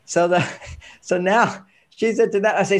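ordinary talk, nothing marked

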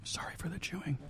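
breathy ASMR-style whisper. Real, not acted. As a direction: whisper it close to the mic. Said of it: "A S M R".

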